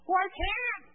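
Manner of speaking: high, loud objection; mock protest. Preacher playing a churchgoer told to stay silent, mock protesting. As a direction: blurt it as an indignant objector. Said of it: "I'm not supposed to say a thing".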